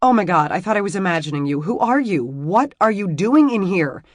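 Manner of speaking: fast, loud, high panicked rush; words tumbling over each other in fright. A narrator's reading. Emmy confronting a stranger in her room, panicked rush. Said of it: "The words tumbled one over the other incoherently".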